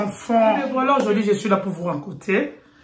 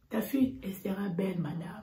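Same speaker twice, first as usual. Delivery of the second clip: whispered; 13 dB quieter than usual. Retold or imitated. Doctor voice, whispered reveal.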